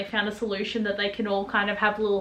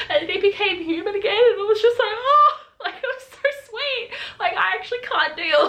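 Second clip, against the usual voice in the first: gushing delight; 9 semitones higher than usual. Real, not acted. Gushing over the reunion scene.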